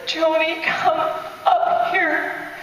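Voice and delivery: drawn-out, breathy summons; Jesus calling Joni out of the tomb, slow and tender; slow tender divine call. Retold or imitated.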